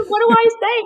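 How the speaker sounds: very high, squeaky laughing question; laughing helplessness. Retold or imitated. Wife asking husband what to say, high and laughing. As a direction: ask helplessly through laughter.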